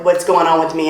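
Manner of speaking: ordinary delivery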